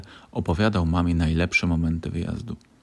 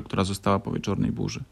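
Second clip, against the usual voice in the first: ordinary delivery, nothing marked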